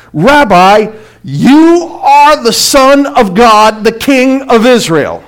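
awed proclamation. Acted. Preacher voicing Nathanael's confession to Jesus, loud and awestruck. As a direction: proclaim it slowly, full voice, in awe.

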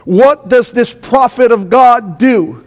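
ordinary talk

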